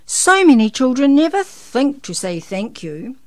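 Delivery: big emphatic praise from Santa; jolly Santa. A narrator's reading. Santa remarks on children's manners, big-voiced.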